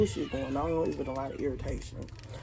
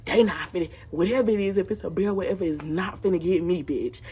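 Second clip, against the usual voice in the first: louder, pumped-up yelling while running; excited shout; 6 dB louder than usual. Retold or imitated. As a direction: yell it while fleeing a bear. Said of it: "I'm like".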